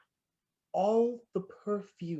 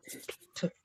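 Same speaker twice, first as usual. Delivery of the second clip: whisper; 13 dB quieter than usual. Acted. Savoring her word.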